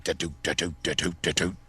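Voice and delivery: steady low rattle noise; imitated rattle. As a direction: mimic a rattling fan in a low voice. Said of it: "it rattles and shit. It's like".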